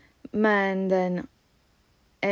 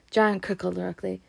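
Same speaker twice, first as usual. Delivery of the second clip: plain reading voice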